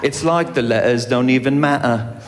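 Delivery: glottal stops in "letters" and "matter"; English-style "le'ers" and "ma'er"; skipped T's. Shown as a demonstration. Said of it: "you just skip over it like that".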